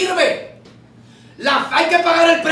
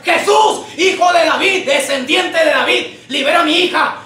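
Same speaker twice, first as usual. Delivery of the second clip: raised, urgent cry for help; shouted plea; 2 semitones higher than usual. Acted. Desperate mother crying after Jesus, shouted plea. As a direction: call out to someone who keeps walking. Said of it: "Si le estoy gritando en su cara".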